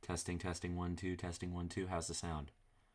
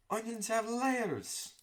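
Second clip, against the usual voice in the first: Shrek impression; much slower than usual. Vlogger retakes the Shrek onion line in a bad Scottish accent. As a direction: draw it out like a hammed-up Shrek. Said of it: "I can't do a good, uh, Shrek impression".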